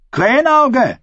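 high, drawn call. A narrator's reading. Ascher calls out to Krähenauge, high shout. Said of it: "rief Ascher".

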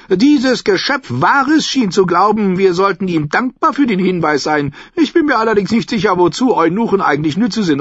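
high, loud voice of a mocking old noblewoman; a sly, tart old lady holding court; Olenna Tyrell. A narrator's reading. Olenna mocking a rival to Sansa, high and loud.

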